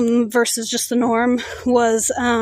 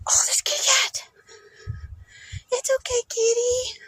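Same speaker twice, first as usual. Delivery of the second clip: high whispered pet talk; whispery high voice for a kitty; cooing to a cat; 12 semitones higher, 6 dB quieter than usual. Real, not acted. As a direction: coax a stray kitten gently, way up high. Spots a stray cat.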